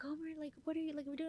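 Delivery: breezy sister calling to check in; high sisters voice. Retold or imitated. Sisters on the phone asking what she's doing, high and soft. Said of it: "they'll be like".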